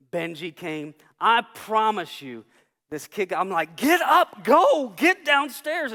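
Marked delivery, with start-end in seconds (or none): 3.8-5.9 loud, high-pitched shouted orders; urgent command. Retold or imitated. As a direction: shout them out of the room. Acted orders during the fight.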